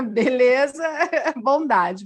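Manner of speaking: a real laugh breaking up the reply. Real, not acted. Amused reply to a compliment while bald, laughing.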